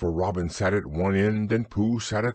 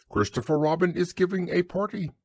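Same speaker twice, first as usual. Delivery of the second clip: Owl voice; 10 semitones higher, faster than usual. A narrator's reading.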